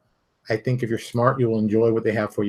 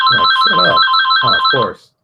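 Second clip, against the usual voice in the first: higher, louder, breathy snap; a burst of mock exasperation; exasperated retort; 3 semitones higher, 7 dB louder than usual. Real, not acted. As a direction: shut them down sharply. Retorting to scoffers.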